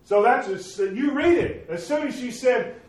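high, loud, fervent pulpit preaching; preaching at full force. Acted. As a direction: drive it home to the congregation.

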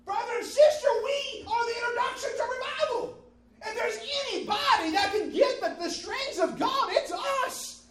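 loud, high impassioned shouting; full-force preaching. Real, not acted. Preacher urging congregation to have faith, impassioned shouting. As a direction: preach it at the top of your voice.

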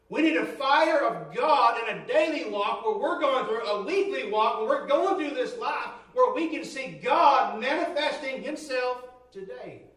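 loud, driving preacher's cry for fire; fervent preaching. Acted. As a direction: build it up like a revival call. Preaching at full force.

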